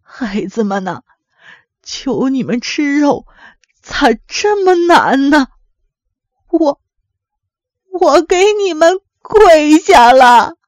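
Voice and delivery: put-on slow, drawn-out plea of an old mother; pleading. A narrator's reading. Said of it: "只好哀求说". (老母亲拖长声音苦苦哀求)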